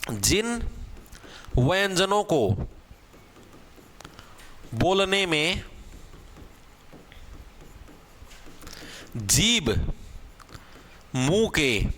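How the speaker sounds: slow, word by word with long pauses; teacher dictating the definition slowly; dictation pace. Shown as a demonstration. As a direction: dictate it so they can write. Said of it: "definition repeated for dictation".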